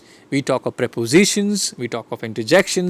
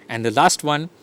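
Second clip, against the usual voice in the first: ordinary talk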